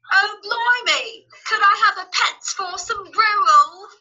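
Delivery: high, drawn-out Oliver-style British urchin; exaggerated stage British, pitched high; British accent. Shown as a demonstration. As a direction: beg slowly in a hammy British accent. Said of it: "I learned my British accent doing Oliver".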